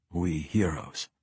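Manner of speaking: soft, low sardonic mutter; muttered under breath. A narrator's reading. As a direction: mutter it so only one hears. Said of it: "Entreri muttered under his breath".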